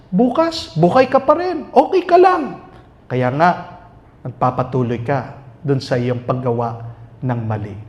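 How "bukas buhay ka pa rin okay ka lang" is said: high, coaxing devil's whisper of reassurance; tempting devil voice. Acted. Priest voicing the devil tempting the sinner, coaxing and high. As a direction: coax like the tempter, high and sweet. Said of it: "the devil convinces us".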